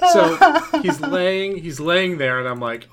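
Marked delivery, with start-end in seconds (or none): none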